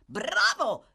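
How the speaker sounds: high, loud onlooker's cheer; shouted approval. A narrator's reading. A spectator at an attic window, shouting approval. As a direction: call it down to the street. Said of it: "gridò uno spettatore dalla finestra".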